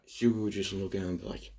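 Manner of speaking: ordinary talk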